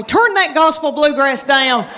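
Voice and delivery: mild scolding holler for comic effect; mock yell. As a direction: mom pretending to tell off her son. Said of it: "if I had to yell at my boy".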